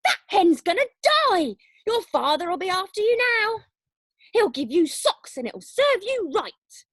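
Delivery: loud, high gloating rant; old woman shouting at the boy; scolding shout. Acted. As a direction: yell threats at the boy. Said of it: "Grandma shouted".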